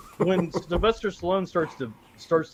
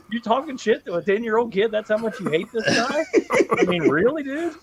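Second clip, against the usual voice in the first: incredulous mock scolding; 8 semitones higher than usual. Mocking the villain's taunt.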